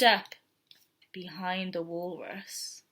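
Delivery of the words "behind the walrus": slow, soft creeping voice; sneaky hush. A narrator's reading. Narrator creeping up behind the walrus, hushed. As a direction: tiptoe the words in softly.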